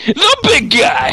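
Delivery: high, loud, slow ring call; announcer shout. Pretend.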